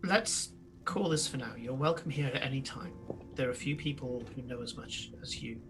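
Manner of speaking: low, very soft, measured voice; hushed parting counsel, fading almost to a whisper; solemn mentor figure. Acted. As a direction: speak quietly with long, weighty pauses. Game master plays a figure releasing someone.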